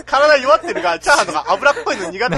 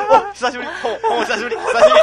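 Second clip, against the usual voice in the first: laughing greeting; 5 dB louder than usual. Acted. Gleeful greeting from letter.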